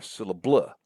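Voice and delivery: SYLLABLE misstressed as syl-la-BLUH; wrong final stress. Shown as a demonstration. Lecturer showing a silly version, stress wrongly on the end. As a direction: push the weight onto the last part of SYLLABLE. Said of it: "Those would sound silly".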